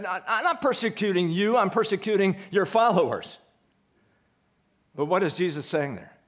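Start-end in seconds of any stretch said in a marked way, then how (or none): 0.0-3.4 much higher, laughing mock objection; mock protest. Preacher imagining Paul arguing back, in a high laughing mock protest. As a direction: play the baffled objector for a laugh. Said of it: "Paul could have said".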